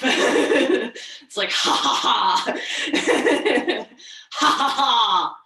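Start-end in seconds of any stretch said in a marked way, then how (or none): 1.5-5.4 loud mock belly laugh; hearty performed ha ha ha. Shown as a demonstration. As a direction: laugh from the belly, big and free. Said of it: "a belly laugh".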